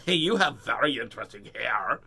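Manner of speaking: loud, rude, sneering; mean version. Shown as a demonstration. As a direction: say it rudely to her face. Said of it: "mean to say it like that".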